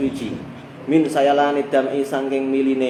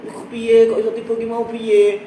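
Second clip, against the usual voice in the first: higher, louder fussing mother, overdone; dramatizing mother; 7 semitones higher, 4 dB louder than usual. Retold or imitated. Mother fusses over her fallen child, overdramatic and higher. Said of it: "malah mendramatisir".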